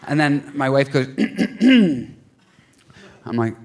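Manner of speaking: usual speaking voice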